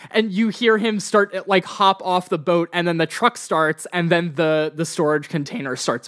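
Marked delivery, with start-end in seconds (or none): none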